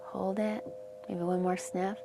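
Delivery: soft, hushed breath-work cues with long pauses; guided breathing. Acted. Meditation teacher guiding the class through breaths, softly. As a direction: gently coach each inhale and hold.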